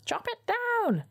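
high, slow screeching automaton; automaton shout. Acted. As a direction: screech like a clockwork bird.

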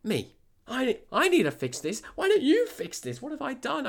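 high, loud, exasperated comeback; rising outburst of incredulous protest; indignant disbelief. Retold or imitated. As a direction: act out affronted innocence. Teller retells indignant reply to boyfriend.

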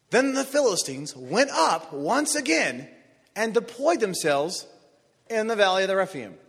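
slow, loud, solemn scripture read. Acted. Preacher reading the verse to the congregation, slow and loud. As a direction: proclaim the verse slowly to the church.

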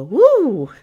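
high, loud "woo" of delight; loud mock swoon; imitated pleased woo. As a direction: imitate a swooping whoop of pleasure. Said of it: "then I see him go".

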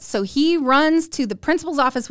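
normal talking voice

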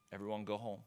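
usual speaking voice

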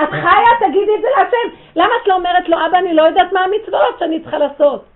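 loud, high, impassioned urging; fiery preaching. Real, not acted. Preacher urging a confused student, loud and high. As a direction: push her hard to speak to God.

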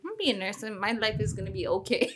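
soft restatement of a hopeful plan; quiet retold self-assurance. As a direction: say it softly, as if reassuring yourself. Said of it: "that's how I was saying".

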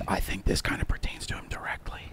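soft unvoiced whisper. Real, not acted. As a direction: breathe it out quietly. Whispered rebuttal after rant.

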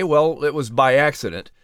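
usual speaking voice

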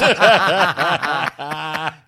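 big open burst of laughter; hearty real laughter; loud laugh. Real, not acted. Laughing at story.

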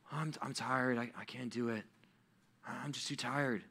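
soft, weary mock whine; tired excuse. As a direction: beg off quietly as if worn out. Student pleading tiredness to leader.

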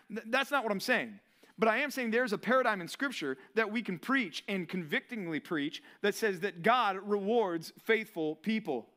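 ordinary talk, nothing marked

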